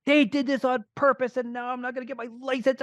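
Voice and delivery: high, indignant outburst from the son; angry teen. Retold or imitated. Son fuming at his dad about the closed office, angry. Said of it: "and he is so angry".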